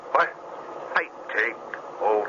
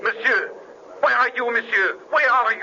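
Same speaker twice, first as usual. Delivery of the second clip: calling out. Acted. Calling out for master.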